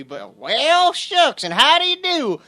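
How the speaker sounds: high, hammed-up hillbilly drawl; mock the Southern yokel twang, swooping high; mock Southern accent. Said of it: "listen how these idiots talk".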